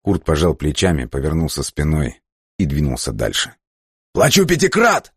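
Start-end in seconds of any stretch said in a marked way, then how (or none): 4.1-5.1 much higher, loud yell; yelled offer. Acted. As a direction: bid shouted at his back. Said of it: "вслед ему выкрикнул Папандреу". (выкрик вдогонку)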